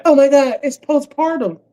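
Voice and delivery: high mock-shocked voice; high put-on gasp of surprise; mock exclamation. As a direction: squeal it like a pretend alibi. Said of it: "And be like".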